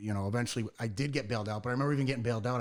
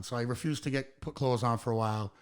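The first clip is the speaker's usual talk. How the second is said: ordinary talk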